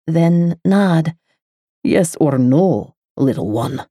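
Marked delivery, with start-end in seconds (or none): 1.8-3.9 low slow male voice. A narrator's reading. The lover pressing the girl, slow and low. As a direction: lower and slow, coaxing.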